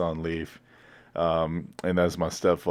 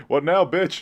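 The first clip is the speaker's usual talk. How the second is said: higher, cocky challenge; cocky taunt; 11 semitones higher, 4 dB louder than usual. Pretend. Cousin imagines taunting a car dealer with his dad behind him, cocky. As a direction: throw down the gauntlet at the dealer.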